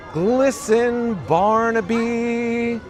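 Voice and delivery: playful high voice. Pretend. Playful high drawn-out bit.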